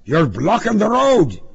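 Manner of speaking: high, forceful shout of a traffic cop; husky shouted command; loud husky cry. A narrator's reading. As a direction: bellow it as an urgent order. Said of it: "the voice cried".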